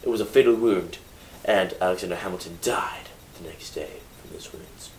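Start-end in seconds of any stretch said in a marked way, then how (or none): 1.4-4.9 whisper. Real, not acted. Telling of Hamilton's death.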